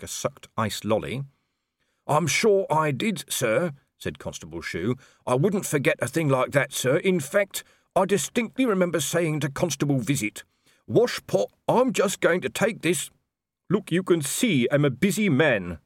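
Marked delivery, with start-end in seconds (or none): 2.1-13.1 higher, polite junior officer voice; dutiful young constable. A narrator's reading. Constable Shu politely asking Colon for the wages chitty. 13.7-15.8 snapping. A narrator's reading. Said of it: "snapped Colon".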